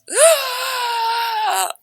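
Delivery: long high loud gasp; ecstatic gasp. Retold or imitated. Steve hit in the chest by the light, huge high gasp. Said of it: "and he goes".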